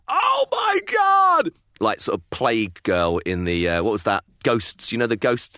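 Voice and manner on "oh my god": slow, high mock scream of fright; frightened shriek. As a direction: act out the startled jump. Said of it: "genuinely like".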